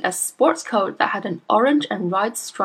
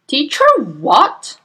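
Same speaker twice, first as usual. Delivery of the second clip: baffled father; 6 semitones higher, 7 dB louder, much slower than usual. A narrator's reading.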